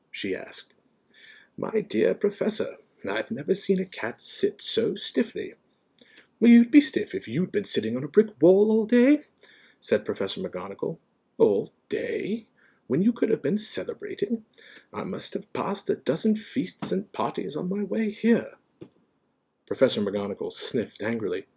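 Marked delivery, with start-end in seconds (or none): 1.6-5.5 lightly voiced genial wizard, pitched up; Dumbledore. A narrator's reading. Dumbledore to the professor, gently teasing. 6.4-9.3 indignant professor, high and loud; McGonagall. A narrator's reading. McGonagall stiff from the wall, indignant retort. 11.4-18.6 genial wizard, raised pitch; mild, puzzled surprise, pitched up; Dumbledore. A narrator's reading. Dumbledore to McGonagall about feasts, mildly surprised.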